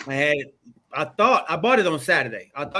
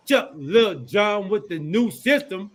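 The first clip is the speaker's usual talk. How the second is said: usual speaking voice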